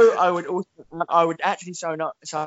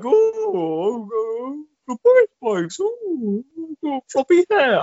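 higher, bumbling posh Boris voice; Boris Johnson impression; 11 semitones higher, slower than usual. Mock Boris Johnson dithering on a prank show, higher and bumbling. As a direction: ham up a flustered, dithering Boris. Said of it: "best impression you've ever done".

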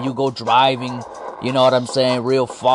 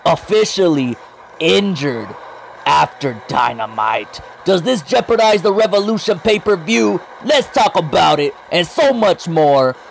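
upbeat announcer-style podcast intro; hype intro; 4 semitones higher than usual. Acted. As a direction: punch it like a show opener.

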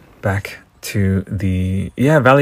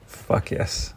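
breathless whispered "Fuck yes"; hushed private yes; 10 dB quieter than usual. Real, not acted. Repeats cheer under his breath.

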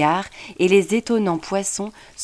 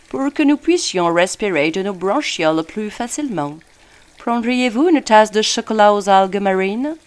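put-on French spoken with a posh English accent; a polite British gentleman speaking French; 4 semitones higher, 4 dB louder than usual. A narrator's reading. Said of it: "déclara avec un accent anglais des plus convenus".

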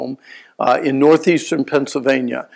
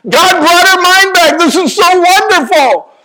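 much higher, louder and slow, near tears; choked-up high thanksgiving; tearful joy; 8 dB louder, slower than usual. Retold or imitated. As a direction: cry out in grateful amazement. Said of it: "Now I'm weeping".

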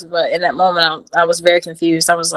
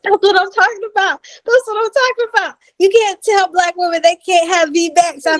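high, hyped-up cheer; the doctor crowing over a successful VBAC; excited doctor; 10 semitones higher than usual. Retold or imitated. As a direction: celebrate like the proud doctor. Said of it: "the doctor was just like".

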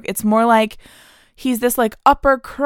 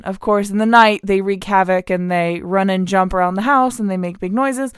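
ordinary talk, nothing marked

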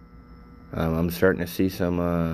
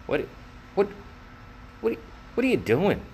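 exasperated disbelief; 8 semitones higher, 4 dB quieter, faster than usual. Retold or imitated. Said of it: "and it's like".

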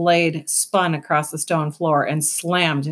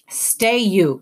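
firm raised call to halt; cried command; 3 semitones higher, 4 dB louder than usual. Acted. Vicus crying out for the others to halt. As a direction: call out to stop them. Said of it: "cried Vicus".

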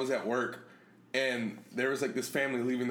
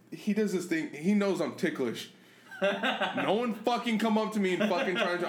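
usual speaking voice

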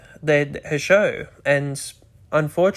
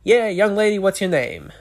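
stage voice; 4 semitones higher than usual. Shown as a demonstration. Host demoing a stage voice to a guest, showy. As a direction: play to the crowd like a stage emcee. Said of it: "they'll be like a stage voice".

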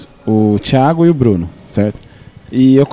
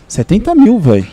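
astonished; 8 semitones higher, 3 dB louder than usual. Real, not acted. Astonished at seventy thousand.

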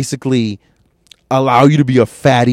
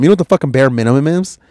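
usual speaking voice

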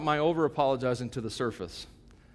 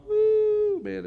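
excited whoop; 4 dB louder than usual. Retold or imitated. Celebrating finishing first mile.

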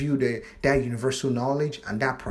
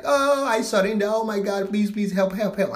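pitched way up, begging for help; mock desperate plea; 8 semitones higher, 4 dB louder than usual. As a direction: play the broken ego crying out to God. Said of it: "And then you're like".

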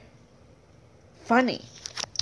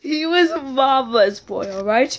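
normal talking voice

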